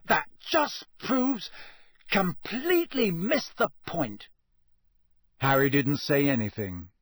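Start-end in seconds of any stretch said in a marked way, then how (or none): 0.1-4.3 very high, slow, indignant splutter; sputtering. A narrator's reading. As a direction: grope for a comeback, thunderstruck. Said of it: "he sputtered, looking thunderstruck".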